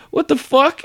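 very high disbelieving squeak; stunned. Retold or imitated. Telling friend about a weird dream, very high stunned squeak. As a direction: squeal it in shock. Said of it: "I just remember being like".

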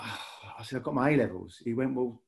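normal talking voice

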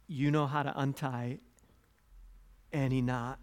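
soft, earnest prayer voice; closing prayer. Acted. Pastor closing the sermon in soft earnest prayer. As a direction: pray quietly over the church.

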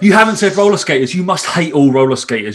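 hammed-up indignant accusation; mock outrage. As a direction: rant like an absurdly offended objector. Parodying an outraged online objector.

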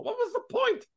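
squeaky, mock-exasperated falsetto; comic high whine of protest; high-pitched exasperation. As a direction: throw the voice way up in disbelief. Exasperated rhetorical question about booking.

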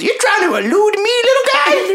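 high, put-on gnome shopkeeper; squeaky-high gnome merchant. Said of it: "And he goes".

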